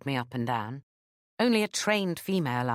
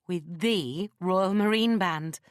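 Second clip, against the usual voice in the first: "the Royal Marine Band" drawn out slowly and higher; emphasized words; 4 semitones higher, 4 dB louder, slower than usual. A narrator's reading. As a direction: savour "the Royal Marine Band" with heavy, slow stress. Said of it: "I emphasized the words".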